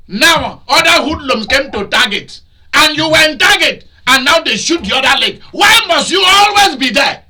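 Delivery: high, loud, scolding sermon delivery; fiery preacher rebuking the congregation; preaching at full force. Acted. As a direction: thunder it at them from the pulpit.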